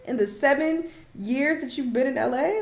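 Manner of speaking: loud and high, still in disbelief; exasperated outburst. Real, not acted. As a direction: let the exasperation blast out. Continues incredulous rant.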